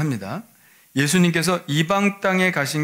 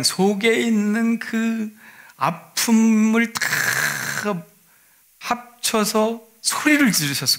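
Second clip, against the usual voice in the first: higher, slow, aching voice; pained plea; 7 semitones higher, much slower than usual. Acted. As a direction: pour out the hurt slowly. Imagining the mother's pained cry. (아픔을 담아 높고 느리게)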